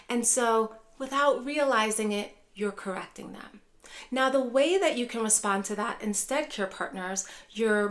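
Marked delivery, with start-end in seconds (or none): 2.1-3.6 soft unvoiced aside; whisper. Real, not acted. Host confiding to care partners, whispered. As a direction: let them in on it in a hush.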